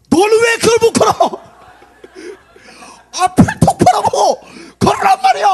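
high, very loud yelling; mock scolding parent. Parent yelling at a fallen one-year-old, high mock scolding. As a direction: holler hammed-up blame at the toddler.

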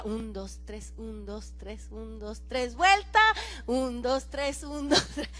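soft, low waltz count; counting dance steps. Shown as a demonstration. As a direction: count the waltz under your breath. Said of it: "Se acuerdan la quinceañera". (contando el vals en voz baja)

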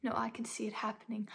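ordinary talk

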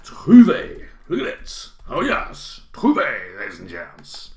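excited teacher revelling in the word, with loud bursts; carried away. Real, not acted. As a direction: let your enthusiasm run away with you. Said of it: "I'm getting carried away now".

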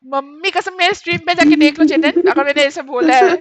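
loud, insistent oath; loud protest. Real, not acted. Player swearing innocence to Chetan, loudly insistent. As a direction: plead your innocence at full volume.

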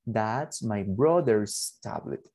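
very slow, careful English; teacher modelling English slowly; slow model sentence. Shown as a demonstration. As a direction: dictate it slowly.

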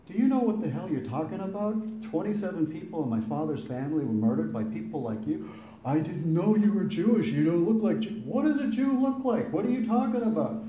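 furious retort. Retold or imitated. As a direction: let him have it, shocked and angry. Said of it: "I was furious".

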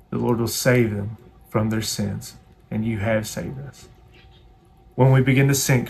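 earnest spoken prayer to God. Acted. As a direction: pray it sincerely for the whole church. Closing prayer.

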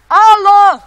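long, high, very loud holler; calling out. Acted. Lost survival host calling for help, loud holler. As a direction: holler it far across the land.

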